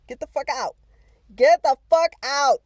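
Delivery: angry shout. Real, not acted. Yelling at the roach to leave the room. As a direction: bark it at the bug.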